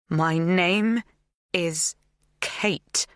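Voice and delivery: My, name, is, Kate each stressed with a pause between; each word emphasized. A narrator's reading. Kate correcting Magda about her name, each word stressed. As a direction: lean on every word separately. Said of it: "I emphasize each word".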